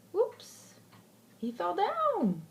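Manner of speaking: playful. Real, not acted. Playful aside to child.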